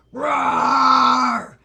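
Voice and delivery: very loud shouted roar; dog roar. A narrator's reading. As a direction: bellow like a charging dog. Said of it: "exclaimed the watchdog".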